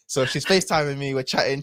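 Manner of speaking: normal talking voice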